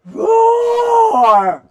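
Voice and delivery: put-on lion roar for the kids; loud roar. Shown as a demonstration. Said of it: "I want to hear your loudest roar".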